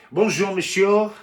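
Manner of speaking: loud, high, slow French greeting; French waiter accent. Acted. Waiter greets a customer, in a loud French accent. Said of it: "'cause he was French".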